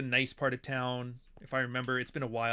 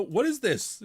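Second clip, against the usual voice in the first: higher-pitched, baffled imitation; mock bewilderment; 9 semitones higher, 4 dB louder, slower than usual. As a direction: act out his confusion, pitched up. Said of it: "He would be like".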